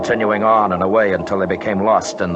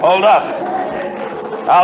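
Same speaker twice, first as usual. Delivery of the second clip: sharp warning call, a bit louder; urgent shout; 11 semitones higher, 4 dB louder, slower than usual. Acted. Climber shouts a warning to his partner: urgent call. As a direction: stop him before the edge.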